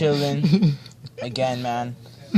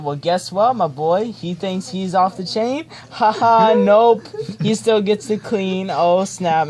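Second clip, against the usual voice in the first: mock gloating dad; 6 semitones higher, 5 dB louder, slower than usual. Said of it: "he's like".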